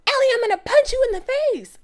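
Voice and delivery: high, loud, fast mock threat in Amanda's voice. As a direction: squeal the threat like a caricature of Amanda. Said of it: "and she says".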